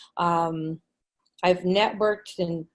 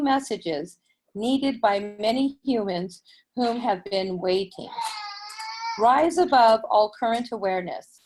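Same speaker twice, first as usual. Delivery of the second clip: channeled message; 3 semitones higher than usual. Acted.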